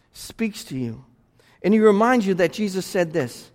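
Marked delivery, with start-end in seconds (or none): 0.1-1.0 much lower and softer, tender; soft hush. Real, not acted. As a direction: drop to a gentle hush. Preacher softens for Spirit.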